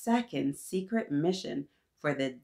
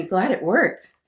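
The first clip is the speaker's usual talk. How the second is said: louder relieved reading; relieved sigh; 8 dB louder than usual. Acted. Jenny glad the invention worked, louder relief. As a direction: let the relief out.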